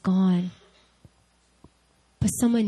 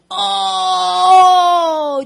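loud, high, drawn-out "oh" of pain; sudden hammed-up cry from a stomach cramp; acted pain groan; 11 semitones higher, 7 dB louder than usual.

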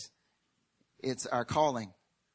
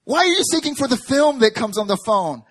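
loud, raised-pitch incredulous question; exasperated shout; 7 semitones higher, 8 dB louder than usual. Acted. Heaven to someone seeking the wrong thing, loud exasperated cry.

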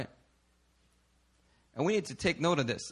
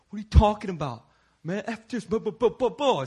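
put-on defensive young man; 5 semitones higher than usual. Young man getting defensive when confronted by the pastor. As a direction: lightly play the brushed-off troublemaker. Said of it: "got all defensive".

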